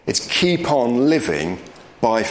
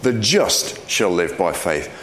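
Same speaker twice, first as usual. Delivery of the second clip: "just" hit first, the rest falling away; stress on JUST; 4 semitones lower than usual. Shown as a demonstration. Preacher showing the emphasis in Galatians, stress on "just". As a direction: punch "just" and let the rest drop. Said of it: "there's an emphasis on just".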